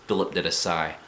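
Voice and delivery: plain reading voice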